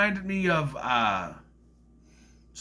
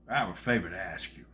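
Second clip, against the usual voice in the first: lower, fast character voice; low Jeff Bridges impression; 8 semitones lower, 5 dB quieter, faster than usual. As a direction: do a quick low imitation.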